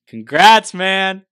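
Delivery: loud, high burst of congratulations; excited congratulations. Real, not acted. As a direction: cheer for the new parent's news. Host congratulates him on newborn.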